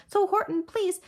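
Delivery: high pleading little voice. A narrator's reading. Tiny mayor begs Horton's help, high pleading. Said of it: "pleaded the voice of the mayor".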